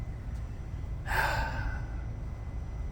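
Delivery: long, soft unvoiced sigh; heavy sigh. Real, not acted. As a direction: let out a weary breath. Pause in a heavy story.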